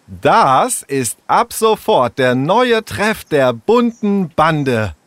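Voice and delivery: proud. Acted. Leo shows Jule the new gang hideout, slow and proud. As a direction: present the new clubhouse proudly. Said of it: "erklärte Leo ihr stolz".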